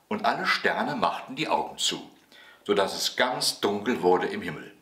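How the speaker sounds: plain reading voice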